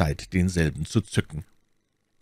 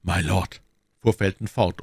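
ordinary delivery

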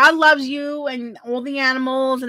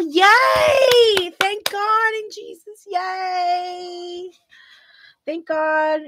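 joyful cheer; 7 semitones higher, much slower than usual. Real, not acted. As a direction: cheer in relief for someone's good news. Cheers at good news about dog.

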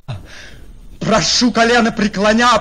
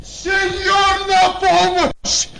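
urgent high shouts for help; calling for help; 8 semitones higher, slower than usual. Acted. Servant shouts to his master for help, alarmed. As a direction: yell for the master to come.

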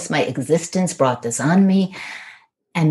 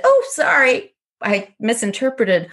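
high-pitched, throwaway mock sorry; mock apology; 7 semitones higher than usual. Mimicking the man's excuse after hurting someone, mock apology. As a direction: toss off an apology in a raised pitch. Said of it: "and I said".

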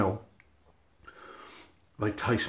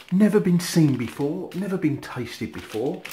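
excited; 6 semitones higher, 4 dB louder than usual. Real, not acted. Hyping a new flavour.

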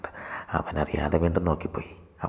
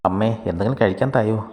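louder, higher call for food; raised-voice request to mum; calling out to mother; 5 semitones higher, 6 dB louder than usual. A narrator's reading. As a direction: call into the kitchen. Brother calls mother for food.

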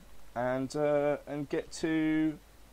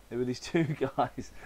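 laughing through the line. Acted. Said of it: "I fucked it up".

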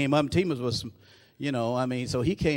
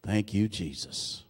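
much lower and softer, slow; soft aside; 8 semitones lower, 9 dB quieter, slower than usual. Real, not acted. Preacher thanking Jesus quietly between lines, hushed and low. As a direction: breathe it out under your breath.

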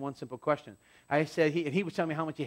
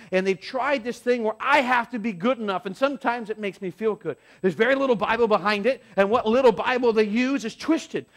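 higher, louder fervent preaching; preaching at full force; 6 semitones higher, 5 dB louder than usual. Acted. Pastor preaching about false religion to hurting listeners, fervent. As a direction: press the point on frustrated listeners.

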